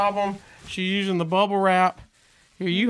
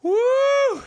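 excited whoop; 3 dB louder than usual. Real, not acted. Whoops at a package.

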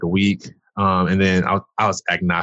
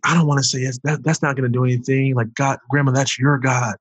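pitched-up, worked-up refusal; retold protest; 6 semitones higher than usual. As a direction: argue back in a higher, insistent voice. Retelling his refusal to grandmother.

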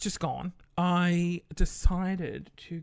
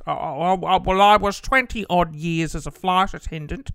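put-on old dad voice, louder; dad's voice; 8 dB louder than usual. Imitating dad explaining his notepad, put-on voice. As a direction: play your dad, fumbling his words. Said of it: "I'll do it in his voice".

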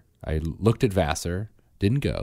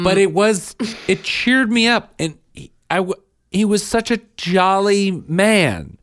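much higher and louder, slower; gleeful gushing; 12 semitones higher, 5 dB louder than usual. Real, not acted. Defending the jolly man to wife, high gleeful gush. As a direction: beam with delight about him.

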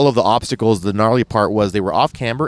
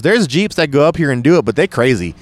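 high-pitched imitation of a Tennessee Southerner; Southern good ol' boy, pitched up; Southern accent; 5 semitones higher, 4 dB louder than usual. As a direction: put on a lively Southern twang. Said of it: "Huge Southern accent".

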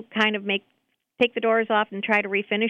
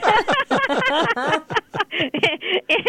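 laughing; 8 semitones higher than usual. Real, not acted. Caller laughing at her own joke.

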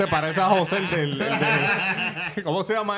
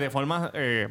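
ordinary delivery

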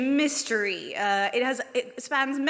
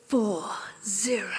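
ordinary delivery

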